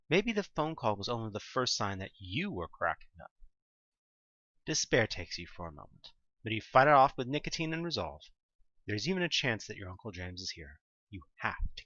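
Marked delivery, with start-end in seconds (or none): none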